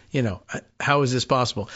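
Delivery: ordinary delivery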